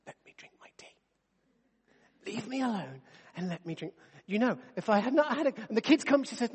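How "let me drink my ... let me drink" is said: slow, whispered and weary; groggy whisper. Retold or imitated. Wife waking up wanting her tea, mock groggy whisper. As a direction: beg to be left alone, half asleep.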